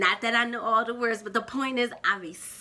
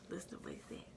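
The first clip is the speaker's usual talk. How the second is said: whispering; 7 semitones lower, 23 dB quieter, much faster than usual. Real, not acted. Hushed aside to the audience.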